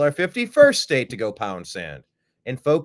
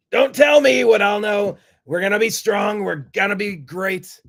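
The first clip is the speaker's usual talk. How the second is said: higher and louder, puffed-up defiance; indignant outburst; 7 semitones higher, 5 dB louder than usual. Acted. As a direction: shout it back like a proud patriot. Mock patriotic outburst.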